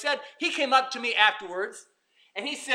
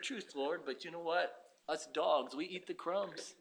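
soft, low, humble voice; a lightly voiced humble woman; humble plea; 6 semitones lower, 13 dB quieter, slower than usual. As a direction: give in meekly. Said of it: "willing to confess the truth".

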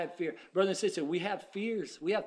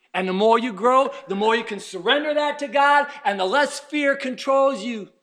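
loud, raised preaching cadence; full-force preaching; 5 semitones higher, 11 dB louder than usual. Real, not acted. Preacher encourages believers to surrender fear, loud exhortation. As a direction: build it up phrase by phrase, loudly.

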